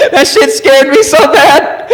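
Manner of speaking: high, slow words through laughter; laughing hard. Real, not acted. Storyteller recalling his Walmart scare, laughing hard. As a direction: squeeze the words out while cracking up.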